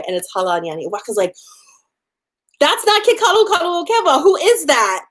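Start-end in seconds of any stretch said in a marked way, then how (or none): 2.6-5.1 high, fast, indignant shock; a grandma stunned by the wrong groom; shocked outrage. Retold or imitated. Grandmother shocked to see the wrong man, high and fast.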